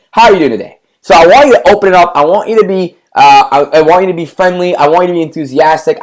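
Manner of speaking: ordinary talk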